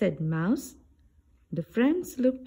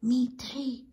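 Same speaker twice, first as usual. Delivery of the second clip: unvoiced whisper, a little higher; 5 semitones higher than usual. A narrator's reading. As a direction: hush it out on breath alone. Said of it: "whispered Bear".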